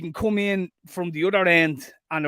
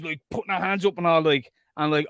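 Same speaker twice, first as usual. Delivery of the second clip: normal talking voice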